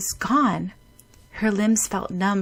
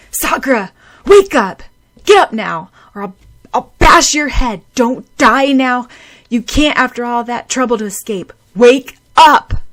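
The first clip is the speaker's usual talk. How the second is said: loud, high, tearful shouting; desperate scream; 5 semitones higher, 12 dB louder than usual. Acted. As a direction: scream at her to wake up. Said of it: "He screamed".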